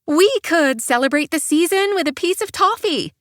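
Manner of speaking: high, upbeat and forced cheerful; plucky. A narrator's reading. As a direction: summon pluck against a blank stare. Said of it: "in the pluckiest voice I can summon".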